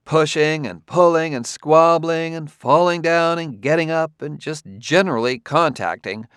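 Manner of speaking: a lightly voiced fretful caretaker; mildly exasperated Clarissa. A narrator's reading.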